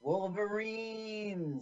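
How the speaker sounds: slow, high stretched call; drawn-out movie cry. Pretend. Quoting Red Dawn battle cry.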